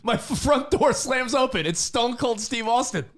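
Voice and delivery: excited storytelling. Retold or imitated. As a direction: pump up the wild story.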